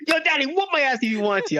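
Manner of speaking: high-pitched imitation of a loyal kid; kid defending dad. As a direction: pipe up as the kid backing daddy. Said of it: "I want my kid to be like".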